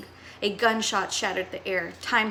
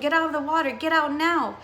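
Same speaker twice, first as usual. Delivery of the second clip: raised, louder yell of warning; screamed warning; 5 semitones higher, 4 dB louder than usual. Acted. Boy yelling at swimmers to leave the water, raised mock shout. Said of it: "he screamed".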